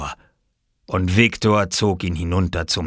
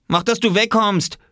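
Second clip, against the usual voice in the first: high, startled shout; startled yell; 4 dB louder than usual. A narrator's reading. Splashed boy chasing off the little one, startled yell. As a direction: yell as if caught off guard. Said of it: "schrie Robert erschrocken".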